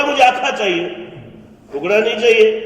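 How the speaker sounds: put-on high, loud childish demand; stubborn child insisting on a whole one; whining child. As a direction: wail it like a spoiled boy.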